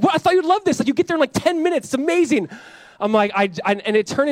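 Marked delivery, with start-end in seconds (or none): 0.0-2.5 high-pitched, enthusiastic sales pitch; retold eager persuasion. Wife tells her scared husband he'd love the helicopter, imitated eager persuasion. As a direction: imitate an excited wife, much higher.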